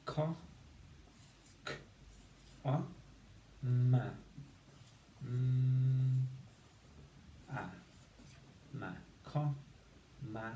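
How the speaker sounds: segmented co, k, o, ma with pauses; sounding out syllables. Shown as a demonstration. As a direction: break «comma» into separate sounds. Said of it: "we should have said".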